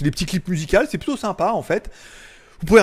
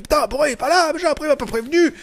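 higher, irritated rant, faster at the end; mock angry viewer; 8 semitones higher, faster than usual. Fan complaining the live has not started, hammed-up annoyance. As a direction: play a fed-up fan whose stream is late. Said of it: "alors toi t'es énervé".

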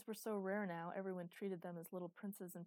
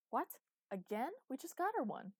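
higher, louder protest; exasperated disbelief; 7 semitones higher, 7 dB louder than usual. A narrator's reading. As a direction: protest as if you can't believe it. Exasperated reaction to buying suit.

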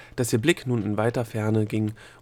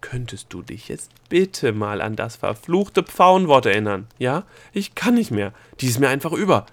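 exasperated; 8 dB louder than usual. A narrator's reading. Gnome begging Delia to remember the word, exasperated. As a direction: lean hard on the words, at the end of your tether. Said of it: "rief der Gnom genervt".

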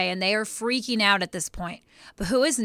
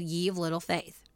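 solemn Jesus voice; 2 semitones lower, 8 dB quieter than usual. Acted. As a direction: say it gently, like Jesus.

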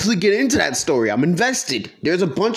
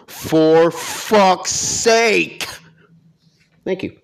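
drawn out very slowly, fed up; long, weary for fuck's sake; exasperated; much slower than usual. Real, not acted. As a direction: stretch it out in disbelief. Exasperated end of rant.

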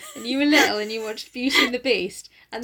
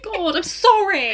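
high, slow, louder mock apology; imagined mortified girlfriend; 8 semitones higher, 5 dB louder, slower than usual. Girlfriend apologising to the wife nearby, mock mortified. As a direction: drag out a cringing apology. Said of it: "can you just imagine her like".

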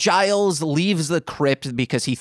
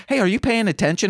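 mock TC; 8 semitones higher, much faster than usual. Retelling TC's question.

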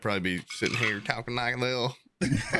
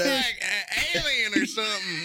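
high-pitched mimicry of a baffled diner; mock a clueless friend, way up high; hammed-up high confusion; mock bafflement; 11 semitones higher than usual.

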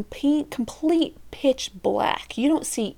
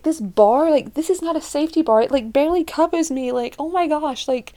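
high, agitated retold panic; re-enacted nervous freak-out; 6 semitones higher than usual. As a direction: protest in a raised, panicky pitch. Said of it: "freaking out, and I'm just like".

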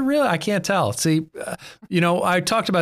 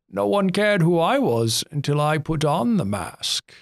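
slow, higher put-on Bane voice; Bane impression; 5 semitones higher than usual. Testing his Bane on the podcast co-hosts, slow villain voice. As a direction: menace it out slowly like the masked villain. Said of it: "Is that a good Bane?".

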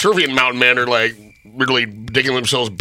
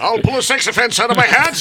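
magician announcer; 9 semitones higher than usual. Pretend. Mock stage magician patter.

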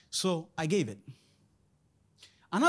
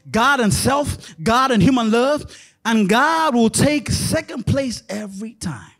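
reading a quote; 7 dB louder than usual. Acted. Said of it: "He says".

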